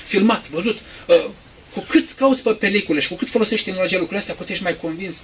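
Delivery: usual speaking voice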